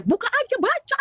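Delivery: very high, urgent imitated command; retold panic. Panicking woman telling sister to open Alkitab, high and urgent. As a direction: re-enact panicking, voice jumping way up. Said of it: "kalau aku panik".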